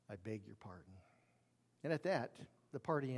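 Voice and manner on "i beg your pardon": much softer, shaken repeat; trembling, almost whispered. Retold or imitated. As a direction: barely get the words out. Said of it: "with a trembling voice".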